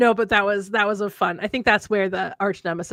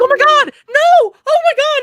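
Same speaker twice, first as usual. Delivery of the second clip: high, loud panicked protest; panicked denial; 12 dB louder than usual. Retold or imitated. Friends denying in horror, loud and high-pitched. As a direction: retell their horrified backpedal.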